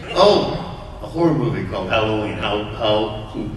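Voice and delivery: dry, mocking how clever; sarcastic mock praise. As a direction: sneer at the title with fake praise. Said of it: "it's like, you're really stupid".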